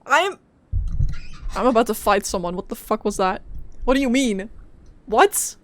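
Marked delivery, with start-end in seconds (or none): none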